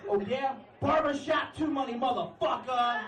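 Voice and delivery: high shouted retort, slow at the end; angry comeback shout. Retold or imitated. Headliner snaps back at the heckler, angry high shout. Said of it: "and he goes".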